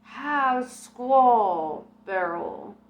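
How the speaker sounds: hammed-up spirit guide; Geronimo voice.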